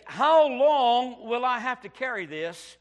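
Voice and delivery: put-on slow, drawn-out plea in a girl's voice; girl's weary thought. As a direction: stretch it out like a tired child. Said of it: "she began to think".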